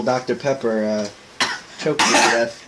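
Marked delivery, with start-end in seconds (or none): 1.8-2.6 rough throat after a coughing fit; hoarse after coughing. Real, not acted. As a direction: speak with a tickle in throat. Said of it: "coughs, choked".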